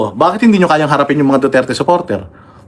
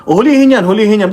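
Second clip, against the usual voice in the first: mock demand; 5 semitones higher than usual. Mocking a demand to arrest hecklers.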